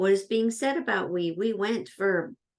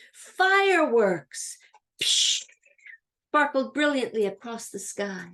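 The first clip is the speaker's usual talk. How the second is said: excited announcement; 3 semitones higher, 4 dB louder than usual. Acted. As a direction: announce it like a big show. Dramatic reading of example sentence.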